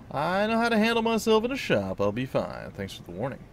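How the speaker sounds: a lightly voiced confident hero; player character voice. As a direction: brush off the warning, lightly in character. Player character replies in own voice.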